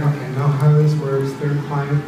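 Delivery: ordinary talk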